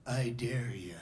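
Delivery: a near-whispered taunt to a buddy; low sly dare. Acted. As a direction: drop low into a hushed, rough dare. Sly dare to a friend in retold scene.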